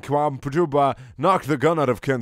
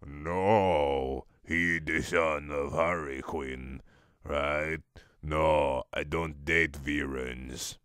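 low mock voice; 7 semitones lower, slower than usual. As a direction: drop low and slow like a shady caller. Character voiced lower on phone.

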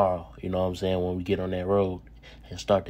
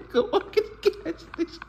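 giggling retelling. Retold or imitated. Repeats the line, giggling.

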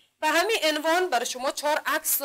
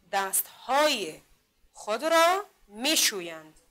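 very slow, louder, word by word; slow model reading; 4 dB louder, much slower than usual. Shown as a demonstration. Teacher reading the sentence to pupils, very slow and loud. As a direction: read it out slowly, one word at a time, for the class to follow. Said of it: "خوب گوش کنین".

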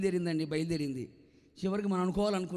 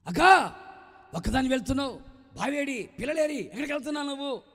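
calling out; 8 semitones higher, 8 dB louder than usual. Acted. Calling to the woman.

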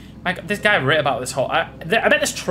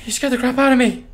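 high, loud startled reproach; startled outburst; 11 semitones higher, 4 dB louder than usual. Acted. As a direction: let the fright burst out loud. Startled by man in room.

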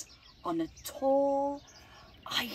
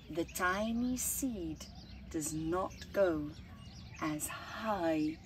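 ordinary delivery

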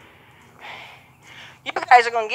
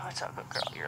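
whispered praise; 15 dB quieter, faster than usual. Real, not acted. Soft praise to the horse.

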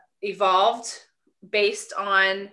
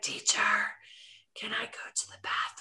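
imitating a pupil's whispered question; hushed mimicry of a student asking permission; 10 dB quieter than usual. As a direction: whisper it to the teacher. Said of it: "brought the vibration down to a whisper".

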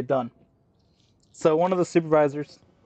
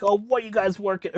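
lightly imitated pestering boss, pitched up; higher mocking voice for a manager's question; mock demanding manager; 7 semitones higher than usual. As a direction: put on a slightly whiny boss. Mimics manager butting in over radio.

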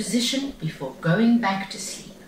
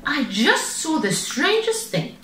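amazed mother; 14 semitones higher than usual. A narrator's reading. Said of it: "she said".

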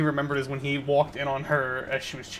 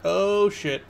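startled shout; 6 semitones higher, 4 dB louder than usual. Real, not acted. Gets caught in game.

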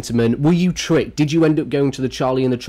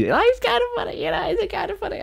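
high, soft squeaky repeat of it's kind of funny; it's kind of funny in a much higher, softer voice; 6 dB quieter than usual. Shown as a demonstration. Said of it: "repeats phrase in different voice".

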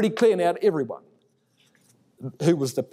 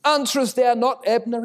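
higher, louder call across the valley; 8 semitones higher, 4 dB louder than usual. Acted. David calls to Abner from the hilltop, a high carrying shout. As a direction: hail him from the hilltop. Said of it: "shouting down the valley".

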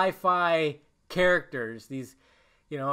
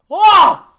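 pained cry; 18 dB louder than usual. Real, not acted. Recalling painful death scene.

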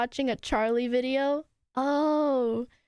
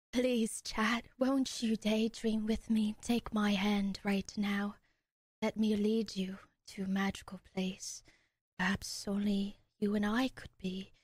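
romantic whisper; 6 semitones lower, 5 dB quieter than usual. Acted. Asking chat to daydream with her, soft dreamy whisper.

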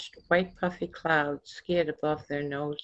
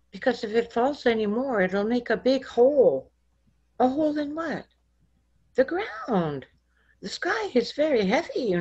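put-on higher, earnest little child explaining; a child explaining to Jesus; small child voice; 8 semitones higher than usual. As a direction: sound like a worried small child.

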